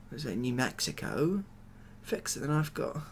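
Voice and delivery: normal talking voice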